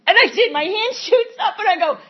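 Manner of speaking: normal talking voice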